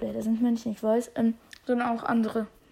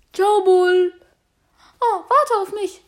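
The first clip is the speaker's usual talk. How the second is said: high, loud call to someone leaving; calling after; 8 semitones higher, 6 dB louder, slower than usual. Acted. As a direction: cry out to be waited for. Piper calls after leaving Bull.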